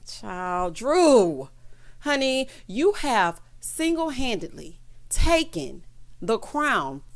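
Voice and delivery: slow, deliberate announcement with weighty pauses; mock-solemn proclamation. Real, not acted. Vlogger crowning Drew worst dressed housewife, mock-solemn and deliberate. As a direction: hand down the verdict word by word, slowly.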